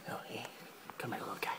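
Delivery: soft coaxing whisper. Acted. Host coaxing a little lizard closer, whispering. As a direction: breathe it out to not scare the lizard.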